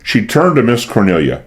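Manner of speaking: ordinary delivery, nothing marked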